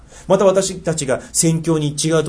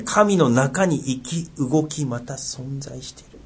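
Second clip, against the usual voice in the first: slow emphatic repeat; much slower than usual. Shown as a demonstration. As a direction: draw out 神の中に生き and 動き, letting each phrase sink in. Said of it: "大事ですね。". (ゆっくり噛みしめるように)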